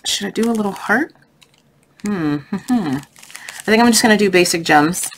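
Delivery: normal talking voice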